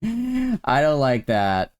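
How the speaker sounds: laughing, slow rerun of I don't like that; amused drawn-out I don't like that. Shown as a demonstration. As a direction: chuckle through it slowly.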